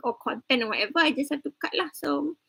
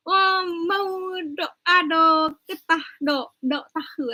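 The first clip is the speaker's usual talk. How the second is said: slow, higher, halting Kelantanese Malay; Kelantan dialect; 5 semitones higher, slower than usual. Shown as a demonstration. Lecturer tries Kelantanese for the class, slow and halting. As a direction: fumble a slow Kelantan accent attempt. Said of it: "You wanna do Kelantan".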